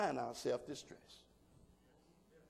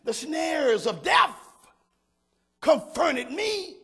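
slow, loud, higher preached line; preaching at full force; 8 semitones higher, 6 dB louder, slower than usual. Acted. Pastor calling out the psalm to the congregation, slow and loud. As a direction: lean into each word of the verse.

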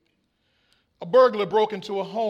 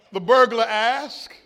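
normal talking voice

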